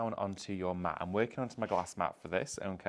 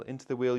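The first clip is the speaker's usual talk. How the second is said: into said higher and louder; stress on into; 4 semitones higher, 6 dB louder than usual. Shown as a demonstration. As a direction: punch into in "into the wheel". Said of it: "look into the wheel".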